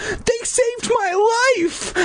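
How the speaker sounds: high, drawn-out protest; emphatic declaration. A narrator's reading. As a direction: insist on it, stretching the words. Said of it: "I declared".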